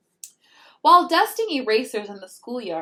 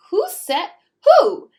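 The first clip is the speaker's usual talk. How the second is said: high, loud startled cry; alarmed high-pitched who; startled kids; 10 semitones higher, 11 dB louder than usual. Acted. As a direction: squeal it out like shocked children. Kids' startled question.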